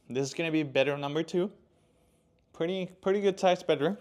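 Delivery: winded after climbing the stairs; out of breath. Real, not acted. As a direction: talk while catching your breath. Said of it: "I'm running out of breath here".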